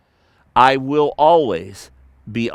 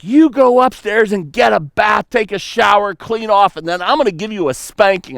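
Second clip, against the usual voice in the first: mock furious mom; 6 semitones higher than usual. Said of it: "was furious with me".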